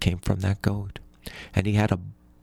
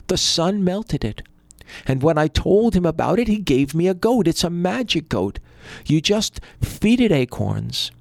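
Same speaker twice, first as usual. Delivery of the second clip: eager poor brother; 7 semitones higher than usual. A narrator's reading. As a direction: explain it high and eager.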